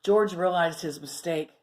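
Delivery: ordinary delivery, nothing marked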